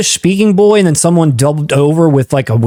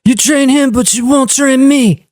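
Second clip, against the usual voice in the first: lightly voiced young Frank Dukes, high; a hint of a petulant movie kid; movie kid line; 6 semitones higher than usual. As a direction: mimic the kid's whiny complaint. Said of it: "They want an encore".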